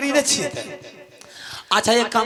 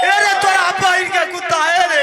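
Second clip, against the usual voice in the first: laughing shout; 7 semitones higher, 5 dB louder than usual. Real, not acted.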